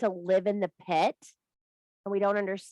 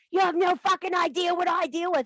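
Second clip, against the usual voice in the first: mock yell; 10 semitones higher than usual. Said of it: "I'm like".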